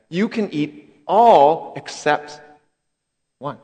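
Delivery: very slow, each word laid out; slow, deliberate. Retold or imitated. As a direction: spell out the one exception, slowly. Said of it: "God actually said".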